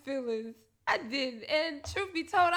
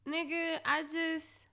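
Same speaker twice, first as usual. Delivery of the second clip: ordinary delivery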